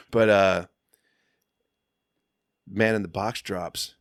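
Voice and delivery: usual speaking voice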